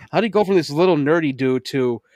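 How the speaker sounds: ordinary talk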